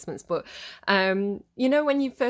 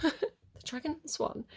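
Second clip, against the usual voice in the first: laughing through the title, softer; amused laugh; 7 semitones higher, 5 dB quieter than usual. Real, not acted. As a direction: chuckle at the pub-like name. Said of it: "It sounds like a British pub".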